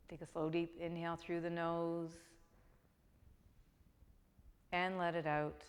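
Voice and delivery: calm, even meditation voice with long pauses; guided meditation. Real, not acted. Begins the guided Yoga Nidra.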